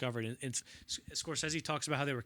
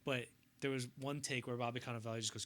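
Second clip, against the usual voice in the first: usual speaking voice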